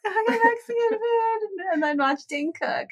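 lightly mocking hubby voice; imitating her hubby. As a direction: play him casually inviting her out. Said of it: "then he's like".

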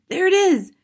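high, loud cry of alarm; brother's high, loud exclamation; excited cry. A narrator's reading. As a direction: cry out that you've heard it. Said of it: "cried the brother".

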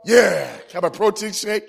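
loud, pumped-up gym yell; mock macho hype. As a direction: shout it like you're psyched to lift. Acts being pumped up at gym.

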